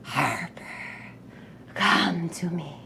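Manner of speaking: a voice inside someone's head, calling gently; ever so quiet. Acted. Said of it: "just ever so quietly, that says".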